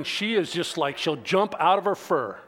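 ordinary delivery

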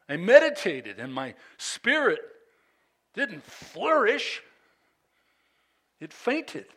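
loud, high surges on key words; dramatic scripture reading. Acted. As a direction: declaim the psalm as a cry to God. Reads the psalm aloud.

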